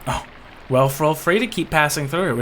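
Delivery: ordinary delivery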